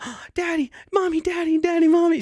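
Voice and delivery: put-on gleeful child's high-pitched chant; excited toddler. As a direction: imitate a thrilled little girl calling for mom. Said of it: "and she was like".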